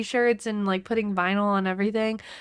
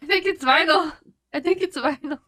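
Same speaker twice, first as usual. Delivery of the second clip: raised voice, higher and louder; loud sleep yelling; 7 semitones higher, 4 dB louder than usual. Pretend. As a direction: yell it like talking in sleep. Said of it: "Just say, "Vinyl!".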